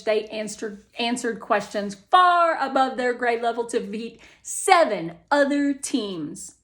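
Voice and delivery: mom proudly reads the article to her daughter; mom reading aloud. Acted.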